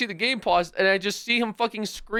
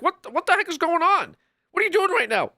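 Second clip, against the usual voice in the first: squeaky higher mock of a confused viewer; 11 semitones higher, 3 dB louder than usual. Streamer mimicking a confused chatter, squeaky and high. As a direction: parrot the viewer in a silly high voice.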